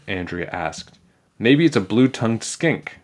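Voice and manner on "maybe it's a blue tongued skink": plain reading voice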